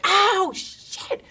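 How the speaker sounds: a loud, high yelp and curse; mock pain yelp. Hurt from the other room, a mock yelp and curse. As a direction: cry out as if you stubbed your toe. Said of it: "from the other room, like".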